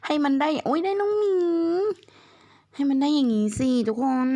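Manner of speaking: high, thrilled exclamation over a lucky draw; excited squeal. Real, not acted. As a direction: squeal with real delight. Delighted at a good pull.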